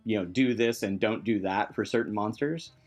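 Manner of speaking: ordinary delivery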